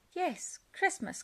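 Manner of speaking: high calling voice. Acted. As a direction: call it after Fox. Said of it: "called Badger".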